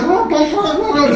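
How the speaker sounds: high, loud string of alarmed ohs; mock alarmed yelping; panicked driver cries. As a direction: cry out while fighting to steer. Pinned driver struggling to steer.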